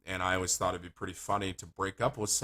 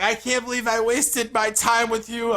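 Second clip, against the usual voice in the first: high, loud mock outrage; imitated angry shriek; mad girlfriend; 14 semitones higher, 8 dB louder than usual. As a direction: rant as the furious dumped girl. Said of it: "she gets so mad at me".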